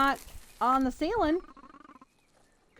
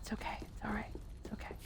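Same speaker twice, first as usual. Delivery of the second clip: soft hushed reassurance; calm yourself down in a whisper; self-soothing whisper; 14 dB quieter, much faster than usual. Real, not acted. Gamer soothes himself after losing progress, hushed and soft.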